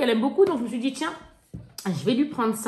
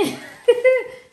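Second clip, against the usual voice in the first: a sudden shrieking laugh, much higher; high burst of laughter; 13 semitones higher, 6 dB louder than usual. Real, not acted. (éclat de rire aigu)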